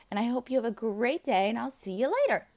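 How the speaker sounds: ordinary delivery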